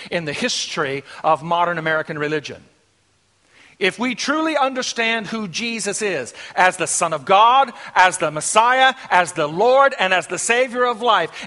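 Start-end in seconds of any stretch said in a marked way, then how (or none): 3.8-11.5 urgent, driving pulpit delivery; forceful preaching. Real, not acted. Preacher telling the flock it's His impact, forceful preaching. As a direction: preach it out with emphatic punches.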